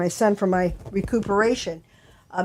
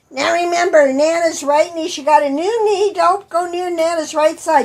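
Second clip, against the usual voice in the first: put-on high, bossy warning to kids; lecturing mom; 7 semitones higher than usual. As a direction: scold the little boys sternly. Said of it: "She's lecturing the boys".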